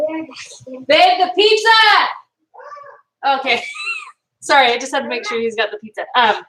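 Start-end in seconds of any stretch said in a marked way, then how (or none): 0.1-1.7 high call across the house. Real, not acted. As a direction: call out to your husband in another room. Calling husband about the pizza.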